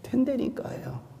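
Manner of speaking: softly, almost breathed; gentle hushed insistence; soft aside. Shown as a demonstration. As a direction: drop to a hush to reassure. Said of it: "그렇게 기도해도 됩니까?".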